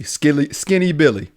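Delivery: usual speaking voice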